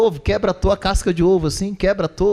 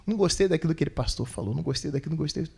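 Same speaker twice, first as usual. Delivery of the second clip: softer, fading sulky mumble; mock sulky grumble; 7 dB quieter, faster than usual. Pastor mimics immature believers complaining, soft sulky grumble. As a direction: grumble like a pouting child.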